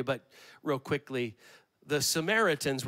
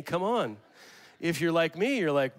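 ordinary talk

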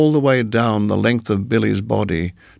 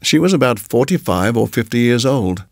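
ordinary delivery

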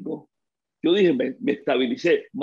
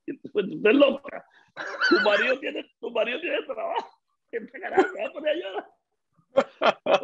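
teasing scold, climbing higher, laughing at the end; mock scolding; 9 semitones higher than usual. Husband teasing his crying wife, mock scolding with a laugh. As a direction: playfully tell her off for crying.

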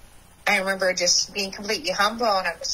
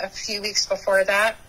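normal talking voice

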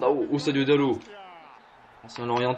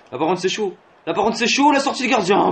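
higher and louder alarm; rising tense cry; tense shout; 7 semitones higher, 7 dB louder than usual. Real, not acted. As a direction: react to the danger out loud. Danger in front of goal.

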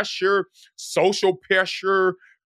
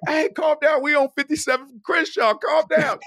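high, agitated retold plea; urgent calming plea; 10 semitones higher than usual. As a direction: frantically try to settle a screamer, acted out. Acted urgent plea to screaming teller.

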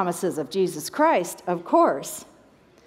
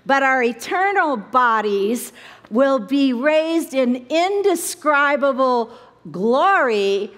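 normal talking voice

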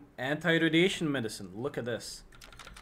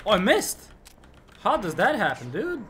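loud, high-pitched disbelief; real indignant gamer yell; exasperated outcry; 7 semitones higher, 9 dB louder than usual. Real, not acted. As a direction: cry out, baffled at your own miss. Dismayed at missing in the game.

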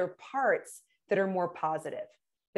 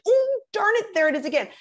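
self-scolding; 11 semitones higher, 5 dB louder than usual. Retold or imitated. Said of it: "I'm like".

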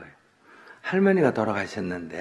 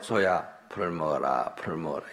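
lower, softer repeated urging; child's quiet coaxing; 5 semitones lower, 4 dB quieter than usual. Acted. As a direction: murmur it over and over, undeterred. Child keeps urging dead ox.

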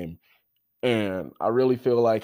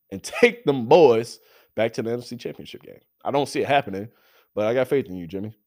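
ordinary talk, nothing marked

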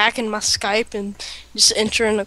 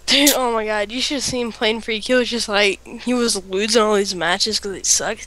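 ordinary delivery

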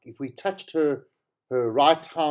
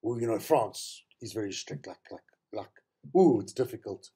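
lower, softer, lightly imitated caution; as if it's difficult; 6 semitones lower, 5 dB quieter than usual. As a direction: retell it as a hedging, hesitant warning. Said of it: "he said it as if".

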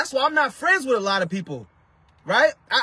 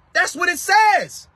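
preaching shout; 6 semitones higher, slower than usual. Real, not acted. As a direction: hammer it home like a pulpit shout. Preacher insisting on scripture's words.

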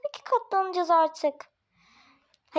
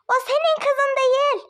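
a sharp outburst, higher and louder; loud accusation; 8 semitones higher, 4 dB louder than usual. Acted. Landlady accuses the couple that the girl is not their child, in a loud higher outburst. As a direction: blurt out the accusation.

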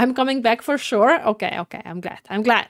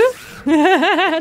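loud, high burst of laughter; laughing; 7 semitones higher, 7 dB louder than usual. Real, not acted. Player laughs during the game, loud laughter.

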